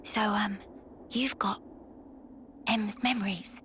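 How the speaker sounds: hushed, furtive low murmur; conspiratorial whisper. Acted. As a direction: sound out a secret under your breath. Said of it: "Why are we whispering?".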